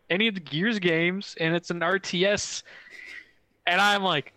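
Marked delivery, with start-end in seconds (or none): none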